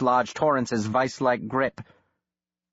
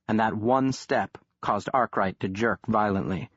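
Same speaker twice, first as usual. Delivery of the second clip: ordinary delivery, nothing marked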